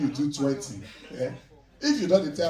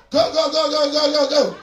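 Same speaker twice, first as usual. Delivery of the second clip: loud high chant of go; shouting trainer; 11 semitones higher, 13 dB louder than usual. Retold or imitated. Second trainer shouting at Bobby to keep going. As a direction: yell it like a trainer at a workout. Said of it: "One dey shout".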